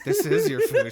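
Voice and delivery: high and loud, cracking with laughter; squealing it out between laughs; laughing shout. Real, not acted. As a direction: insist through helpless laughter.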